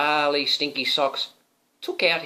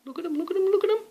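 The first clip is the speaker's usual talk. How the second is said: very high sing-song; squeaky look-at-them; high excited pointing; much faster than usual. Real, not acted. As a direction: point at the picture playfully. Pointing out pictures to child.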